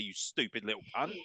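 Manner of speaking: ordinary delivery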